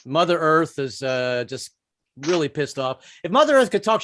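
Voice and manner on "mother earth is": ordinary talk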